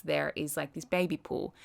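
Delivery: ordinary delivery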